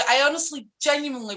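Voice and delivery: normal talking voice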